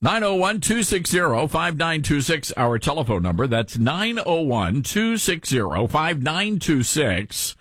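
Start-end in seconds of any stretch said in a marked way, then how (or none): none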